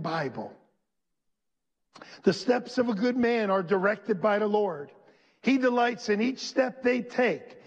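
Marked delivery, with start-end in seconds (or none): 2.2-7.6 rising preacher's scripture reading; preaching. Acted. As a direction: read it up to the crowd, building. Preacher reads scripture aloud.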